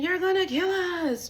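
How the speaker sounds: high, loud, drawn-out mock scream; imitated screaming. Mimicking a woman screaming while she sleeps, high mock scream. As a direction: scream it like someone in a panic, imitating. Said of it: "I hear a bitch screaming".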